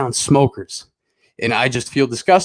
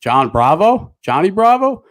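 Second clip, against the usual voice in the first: retold sceptical double-take, pitch and volume jumping up; puzzled disbelief; 7 semitones higher, 4 dB louder, slower than usual. Tweaker questions the fake name, retold in puzzled disbelief. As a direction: query the name, higher the second time. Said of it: "looks at me kinda funny".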